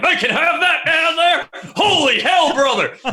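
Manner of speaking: high, loud, hammed-up yell; mock a wowed gun fan shouting; rowdy American commenter. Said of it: "Most of them are like".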